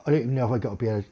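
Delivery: usual speaking voice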